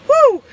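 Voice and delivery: loud, high excited whew. Real, not acted. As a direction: let out a big loud whew. Sudden exclamation on stream.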